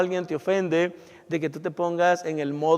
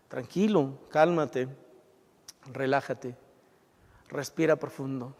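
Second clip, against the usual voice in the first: softer, lower, unhurried calming words; self-soothing calm; 4 semitones lower, 5 dB quieter than usual. Pretend. Preacher coaching the congregation to calm themselves, soft and soothing. Calming oneself when spouse shouts.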